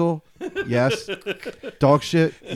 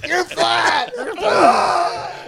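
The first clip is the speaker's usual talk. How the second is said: mock shouting; 11 semitones higher, much slower than usual. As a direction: holler it at him as you drive past. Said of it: "And Gebby's going".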